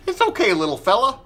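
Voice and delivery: high, sugary mock-soothing voice; coddling parent. Vlogger mocking parents who coddle kids after bad grades, sugary voice. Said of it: "how you build them up".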